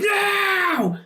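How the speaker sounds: very high, drawn-out mock cry; hammed-up howl of alarm; horrified wail. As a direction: wail in pain at your bleeding hands. Acted reaction to sudden stigmata.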